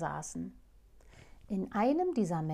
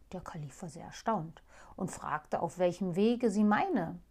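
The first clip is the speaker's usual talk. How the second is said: usual speaking voice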